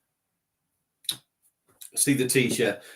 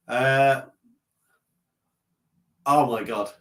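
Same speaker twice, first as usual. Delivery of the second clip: ordinary talk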